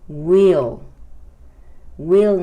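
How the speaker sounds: "Will" said as a long, loud "weel"; pronounced "we'll". Shown as a demonstration. Teacher drilling the contraction of we will, loud drawn-out "Will" as "weel". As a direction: stretch "Will" into "weel", word by word. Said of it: "Se pronuncia will".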